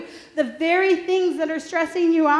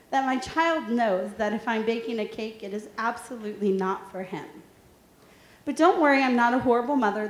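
ordinary talk

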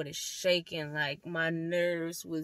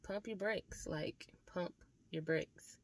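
quiet brush-off; 9 dB quieter than usual. Retold or imitated. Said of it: "And I was just like".

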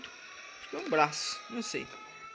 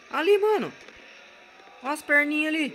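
high, loud alarmed cry; alarmed shout; 9 semitones higher, 7 dB louder than usual. Real, not acted. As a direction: yelp it out in alarm. Spotting the monster.

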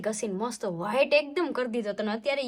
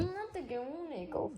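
tearful refusal; 3 semitones higher, 10 dB quieter than usual. Real, not acted. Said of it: "હું કામ રડે છે".

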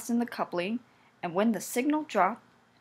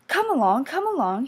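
higher, louder cheerful engine; happy engine coaxing its trucks; puffing engine; 5 semitones higher, 5 dB louder than usual. A narrator's reading. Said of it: "he puffed".

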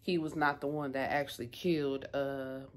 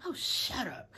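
higher-pitched mock exasperated retort; dismissive retort; 7 semitones higher than usual. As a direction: brush them off with a drawn-out shut up. Retelling a character's retort.

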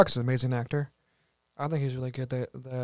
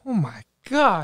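surprised; 4 semitones higher, slower than usual. Real, not acted. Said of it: "That is so high".

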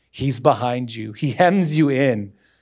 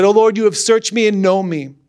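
full-voiced psalm reading, louder and higher; loud scripture reading; 4 semitones higher, 4 dB louder than usual. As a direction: declaim the psalm with rising fervour. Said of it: "I'm gonna read a few verses".

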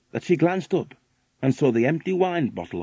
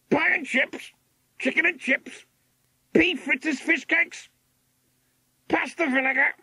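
very high parrot squawk; screaming macaw. A narrator's reading. Macaw screaming out menu items at the yacht couple, squawking. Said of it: "screamed Macaw".

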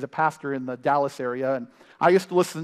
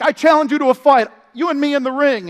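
challenging shout; 9 semitones higher, 9 dB louder than usual. Retold or imitated. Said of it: "he's like".

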